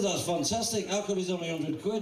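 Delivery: amazed. Retold or imitated. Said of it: "I said".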